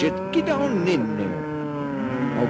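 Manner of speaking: high, loud mocking nag, well above his own voice; mock bossy nag. Host mocks someone ordering a big butt inside, high and loud. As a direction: shove them in there like a shrill scold, hammed up.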